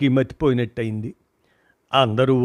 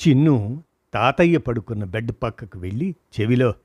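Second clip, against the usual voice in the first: ordinary delivery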